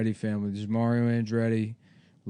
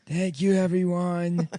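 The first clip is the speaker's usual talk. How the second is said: put-on Italian accent; 6 semitones higher than usual. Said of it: "He's doing an Italian accent".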